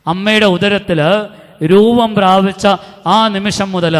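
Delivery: slow, loud preacher delivery, every phrase weighted; fervent preaching. Acted. Retreat preacher driving the point home to children, slow and loud. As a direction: preach it out slowly to the whole hall.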